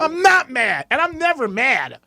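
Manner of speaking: high, hammed-up protest. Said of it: "putting up the level of my voice".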